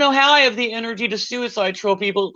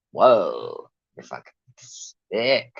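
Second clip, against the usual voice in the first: deep mock voice; 4 dB quieter, much slower than usual. Mocking the trolls, a deep slowed voice. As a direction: voice dropped way down, slow and disgusted.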